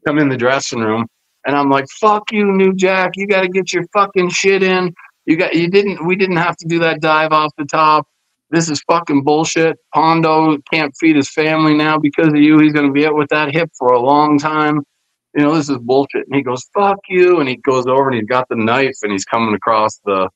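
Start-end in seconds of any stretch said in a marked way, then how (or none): 2.0-16.2 heated, higher-pitched retold rant; indignant, raised-pitch tirade; angry confrontation. Acted dressing-room quarrel with New Jack. 16.7-17.4 angry retort. Retold or imitated. New Jack's angry retort, retold.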